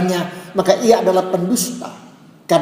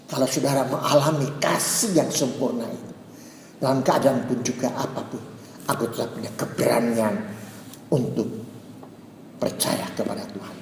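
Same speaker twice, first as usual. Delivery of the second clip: hushed; 6 semitones lower, 8 dB quieter than usual. Acted. Preacher drops to intimate hush.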